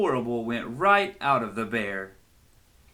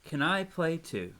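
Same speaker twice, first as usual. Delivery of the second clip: plain reading voice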